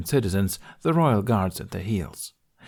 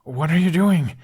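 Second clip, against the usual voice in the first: higher, louder, alarmed demand; 8 semitones higher, 7 dB louder than usual. Acted. Hiccup dragged down the alley, loudly demanding an answer. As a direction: demand an answer while running. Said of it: "Hiccup demanded".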